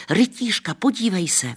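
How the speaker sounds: high, eager cry; excited children. A narrator's reading. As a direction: kids pointing out a lady knight. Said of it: "vykřikly děti". (nadšené dětské vykřiknutí)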